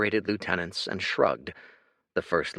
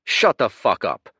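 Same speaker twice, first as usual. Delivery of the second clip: louder, firm command; stern rebuke; 2 semitones higher, 9 dB louder than usual. Acted. Patriarch rounding on his lieutenant, stern. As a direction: shut him down hard. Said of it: "rounding on his lieutenant".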